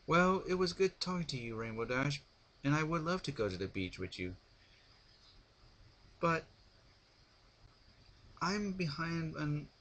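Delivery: lightly voiced posh mare; refined lady voice. A narrator's reading. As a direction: voice Rarity, gracious. Rarity voiced in story.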